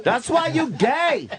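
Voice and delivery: slow, high-pitched mimicked scolding; drawn-out falsetto taunt; mock yelling mother. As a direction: stretch it out high, parodying an angry mom. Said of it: "his mother was just yelling".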